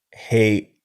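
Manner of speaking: normal talking voice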